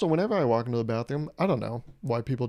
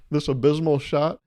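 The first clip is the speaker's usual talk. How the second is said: ordinary talk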